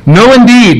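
mother insisting on the name John; emphatic. Retold or imitated. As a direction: refuse outright, leaving no room. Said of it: "it's very emphatic".

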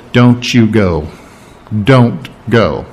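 low, firm voice of God; a slow, grave warning from God; stern God. Retold or imitated. God forbids Balaam from going, low and firm.

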